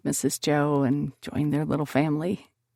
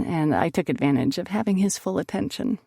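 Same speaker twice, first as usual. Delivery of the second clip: ordinary delivery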